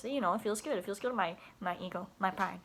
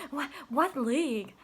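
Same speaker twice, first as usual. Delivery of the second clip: put-on higher, louder eager kid question; 7 semitones higher, 8 dB louder, slower than usual. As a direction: pipe up like an excited child. Kids asking the teacher.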